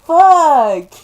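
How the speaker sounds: high, very loud real outburst; anguished swearing. Real, not acted. Reader still reeling from chapter two, anguished shout. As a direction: let the curse burst out in distress. Overcome by chapter just read.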